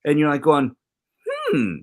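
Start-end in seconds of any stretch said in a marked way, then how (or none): 1.3-1.8 high puzzled hum. Retold or imitated. Imitating a puzzled letter reader.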